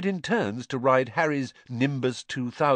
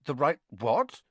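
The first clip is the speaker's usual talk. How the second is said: nervous; 6 semitones higher than usual. A narrator's reading. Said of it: "said Harry nervously".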